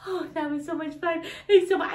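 ordinary talk, nothing marked

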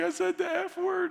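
high, whiny mock sob of a child; put-on tearful kid voice; imitated sobbing. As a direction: blurt it out like a bawling little boy. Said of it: "He starts sobbing".

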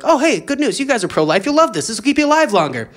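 cheery scientist. Acted. Scientist pitching stem cells to believers, cheery and eager. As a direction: sell the good news eagerly.

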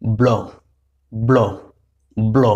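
teacher drilling ব্ল, b and l fused; blended bl sound. Shown as a demonstration. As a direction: repeat ব্ল as one tight bl cluster, no vowel between. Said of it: "যেটার উচ্চারণ হয়েছে কি".

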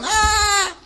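high mock fangirl squeal. Imitating thousands of girls squealing. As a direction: squeal like a starstruck fan.

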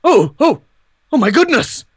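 loud, high cries of alarm; startled. Pretend. Ghost stumbles on humans in the hotel, startled cries. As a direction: jump at the sight of them.

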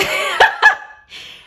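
high, loud helpless laugh; cracking up and unable to stop; real burst of laughter. Real, not acted. Laughing at the friend's crush, high and loud. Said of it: "I couldn't stop laughing".

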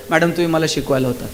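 lightly voiced pupil, low, soft and quick; a hint of a deferential ex-student; respectful former pupil. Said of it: "पायाला हात लावताय".

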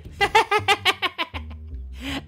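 very high, staccato cackle; evil cackle. Pretend. Player gloating after killing Grandpa, high evil cackle.

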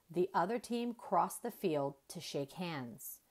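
usual speaking voice

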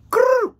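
high, loud trilled "brrr"; punchy vocal ringtone; imitated phone ring. Imitates phone ringing in story.

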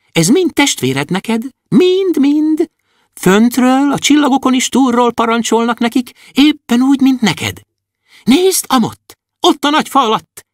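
bright high little dwarf, full of wonder. Acted. Little dwarf to Andris, high and amazed. Dwarf Kökőszi shows the world.

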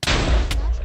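a sudden shouted burst; loud yell. Real, not acted. Gamer yelling at the rival players. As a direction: belt it out at full volume.

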